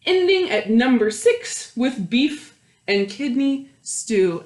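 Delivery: lively storytime read, lingering on the counting; reading to children. A narrator's reading. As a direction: read it aloud to a circle of kids, savouring each house. Reading picture book to children.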